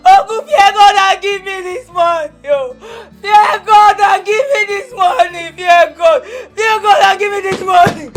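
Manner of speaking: desperate wailing demand to uncle; tearful shouting. Acted. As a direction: sob out the demand at full voice. Refusing to leave, screaming.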